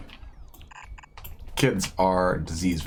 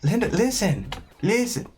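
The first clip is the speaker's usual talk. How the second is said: put-on high, slow kid voice calling for attention; pleading kid; 12 semitones higher than usual. Other kid in the meme begging Linda to listen, high slow kid voice. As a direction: nag a grown-up like a small child. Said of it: "the other kid".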